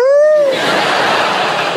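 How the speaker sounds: big crowd burst of laughter; the room erupts laughing; loud audience laugh. Real, not acted. Audience roars at the joke.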